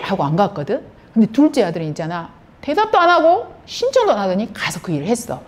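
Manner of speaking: ordinary delivery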